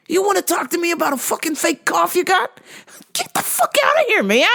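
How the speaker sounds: high, heated mock tirade at the coworker; furious inner rant. To the coworker faking a cough, a high furious rant. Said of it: "And I'm like".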